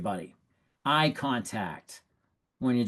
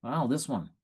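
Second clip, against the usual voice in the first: confident suggestion. Shown as a demonstration. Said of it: "And you say it confidently".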